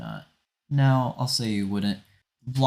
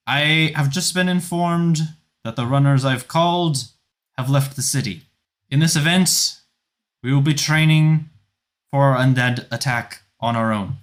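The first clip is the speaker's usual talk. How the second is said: higher, louder orders for the crowd; loud commander; 5 semitones higher, 7 dB louder than usual. Acted. Felane announces the training to the crowd, loud commanding. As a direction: call out the plan like a general. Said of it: "you hear her voice continue".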